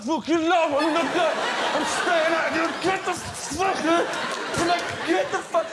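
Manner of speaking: a hoover trunk yelling back at its owner; defiant hoover trunk. Retold or imitated. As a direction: refuse to go in, hammed up. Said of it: "the trunk's like".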